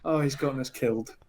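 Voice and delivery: mock sighing dismay; exasperated sigh. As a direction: mutter it softly, as an imitated weary aside. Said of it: "you hear the sigh from".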